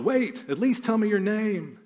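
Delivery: high, drawn-out mock call; acted high-pitched yell after someone leaving; imitated yell. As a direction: call out like a damsel, stretched and high. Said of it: "his lady friend yells out".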